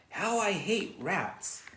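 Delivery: high, slow loathing; disgusted grumble. Acted. Lurvy disgusted at the rat nest, slowly grumbling. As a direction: drag out the hatred.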